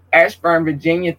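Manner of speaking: usual speaking voice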